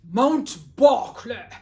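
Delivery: very loud, slow boom; daddy's booming voice. Acted. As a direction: announce it like a mighty mountain's name. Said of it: "as Daddy used to say".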